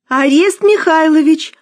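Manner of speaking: high, loud desperate call; desperate wail. A narrator's reading. Musya wailing to her husband, high and loud. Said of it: "В полном отчаянии завопила Муся".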